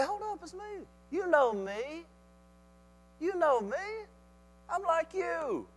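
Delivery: soft, high-pitched plea to friends; gentle reassurance. Acted. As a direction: plead softly to be recognized. Jesus gently reassures the disciples.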